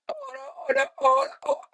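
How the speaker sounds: very high, falsetto warbling cry; mock the fan's trilling call badly; bad imitation of the cheer. Imitating the fan's cheer at the concert, high falsetto warble. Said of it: "That was really bad, I'm sorry".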